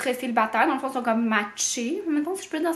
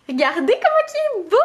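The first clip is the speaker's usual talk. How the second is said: high, louder, thrilled; excited showing off; 7 semitones higher, 3 dB louder than usual. Real, not acted. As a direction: show it off with delight. Showing off new pyjama.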